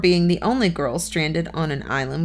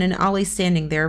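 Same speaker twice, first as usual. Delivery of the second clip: plain reading voice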